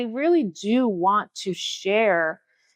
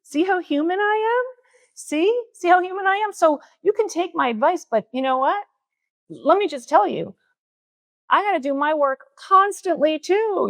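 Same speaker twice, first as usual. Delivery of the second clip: laughing self-mockery; 9 semitones higher than usual. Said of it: "I said".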